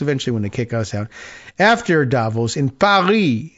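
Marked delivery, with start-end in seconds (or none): 1.6-3.5 mock French accent, Paris as "Paree". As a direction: imitate a Frenchman reading aloud, French accent. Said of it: "trying to do a French accent".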